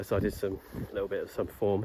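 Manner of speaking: ordinary talk, nothing marked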